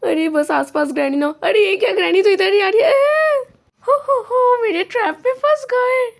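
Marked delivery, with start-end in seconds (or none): 1.4-3.4 higher-pitched panic rising into a yelp; alarmed cry. Acted. Player sees Granny coming, cries out. 3.8-6.1 high-pitched cackling granny voice; gloating villain granny. Acted. Granny gloats over trapped players.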